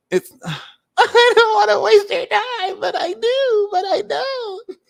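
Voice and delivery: hammed-up tearful whine; high, melodramatic fake blubbering; mock crying. Mock-sobbing over wasting time.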